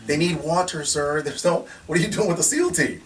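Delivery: insistent retort. Retold or imitated. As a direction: press it home, incredulous. Retelling his outburst.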